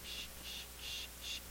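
imitated soft shushing rattle of a clip; quick, hushed "sh" bursts; clip rustling noise. Storyteller imitating a bag clip on canvas, soft noise. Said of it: "that clip went".

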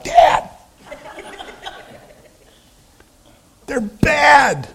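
high, strained mock-urgent warning; alarmed warning. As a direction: warn your dad off in a squeezed, high voice. Acted alarmed warning to dad.

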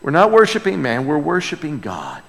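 ordinary talk, nothing marked

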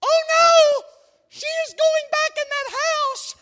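husband imitating wife panicking at a thriller; wife's mock panic. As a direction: scream at the screen as the wife. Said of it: "Here goes Angie. She's like".

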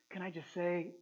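ordinary talk

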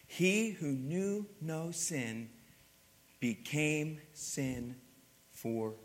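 very slow, weighty; slow solemn stress on "he who knew no sin"; slow emphasis. Acted. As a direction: stretch every word out.

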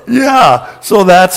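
laughing through the wrap-up. Real, not acted. Preacher finishing his baptism story, laughing. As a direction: let the laugh carry the words.

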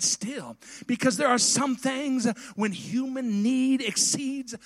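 rising, loud preacher's fervor; full-voiced pulpit preaching. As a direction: preach it out to the congregation. Preaching a prayer plea.

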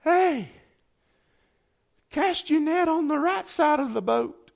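a high call across the water; called out from shore. Retold or imitated. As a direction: pitch it up as if calling from the beach. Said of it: "this person yells out".

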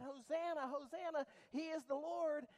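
high, loud shout of hosanna; children calling hosanna; crying out praise. Acted.

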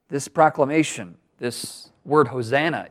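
ordinary talk, nothing marked

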